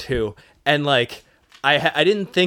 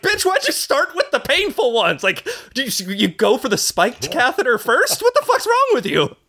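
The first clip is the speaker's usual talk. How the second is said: much higher, slow mock outrage; mock furious rant; 10 semitones higher than usual. Retelling what he wanted to scream at the nurse, high mock outrage. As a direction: rant at the nurse in a squeaky disbelieving voice. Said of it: "I felt like screaming at her".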